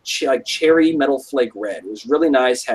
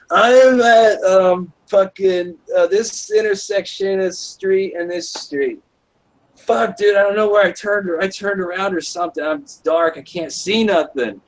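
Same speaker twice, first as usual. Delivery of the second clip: frantic lost call; 6 semitones higher than usual. Retold or imitated. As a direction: sound desperate and lost in the dark. Lost, calling friend for directions.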